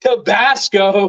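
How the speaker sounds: a dad spotting his favourite sauce again; delighted gasp of discovery; excited. Retold or imitated. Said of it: "And I was so excited".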